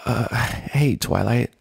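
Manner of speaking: groaned greeting. A narrator's reading. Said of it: "Rainbow groaned".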